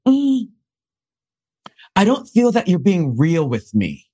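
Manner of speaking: high, hammed-up casting director voice; mock casting director. Casting director tells the actor he isn't real, in a mock high voice.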